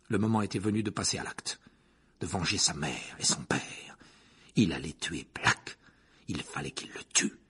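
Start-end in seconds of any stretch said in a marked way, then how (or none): 2.2-7.4 much lower and softer, half voiceless; dark hushed narration. A narrator's reading. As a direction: let the grim thought sink in. Harry's resolve to kill.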